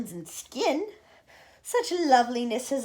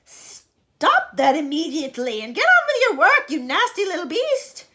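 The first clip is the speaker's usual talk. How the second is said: much louder, higher barked command; harsh order; 6 semitones higher, 10 dB louder than usual. Acted. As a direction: order the boy back to work. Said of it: "Aunt Sponge ordered".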